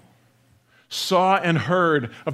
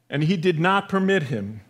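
ordinary talk, nothing marked

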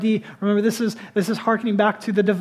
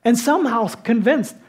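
higher, louder, incredulous sermon push; animated preaching; 5 semitones higher, 4 dB louder than usual. Acted. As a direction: build mock disbelief at the prediction. Preacher mocks Keynes prediction.

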